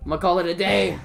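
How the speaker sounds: called out loudly; loud sign-off. Real, not acted. Streamer announcing to viewers he is done, calling out. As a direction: belt out the sign-off. Streamer signing off, then repeats quieter.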